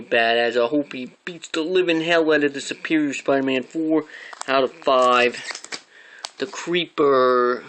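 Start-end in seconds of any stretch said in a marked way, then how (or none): 6.4-7.6 slow, drawn-out title announcement; dramatic title announce. Acted. Announcing the next comic to viewers, slow and dramatic. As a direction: draw out the name like a trailer.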